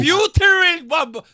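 high, very loud, drawn-out whoop; excited shouting. Real, not acted. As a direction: shout it out in amazement. Amazed at the [name] revelation. (grito agudo de asombro)

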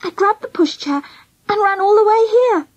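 high, plaintive tearful voice; tearful confession. A narrator's reading. Girl confessing she took a baby, tearful. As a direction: pour it out tearfully, pitched well up. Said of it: "blowing her nose".

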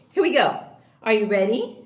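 normal talking voice

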